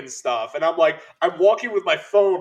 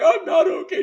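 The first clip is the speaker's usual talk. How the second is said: high, loud mock-tearful wail; imitated crying; 5 dB louder than usual. Husband retelling his answer to his wife, high mock sobbing. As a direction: wail it as if in happy tears. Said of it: "I'm crying".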